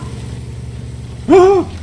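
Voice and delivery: high, sharp mock gasp; panicked gasp. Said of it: "The worst thing you can do is say".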